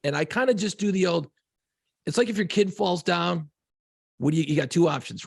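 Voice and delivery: usual speaking voice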